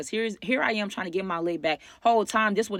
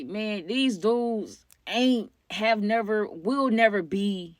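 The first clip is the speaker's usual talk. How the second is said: slow, deliberate verdict; drawn-out emphasis; 3 semitones higher, much slower than usual. Real, not acted. Storyteller delivering the moral, slow and emphatic. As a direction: stretch each phrase out for emphasis.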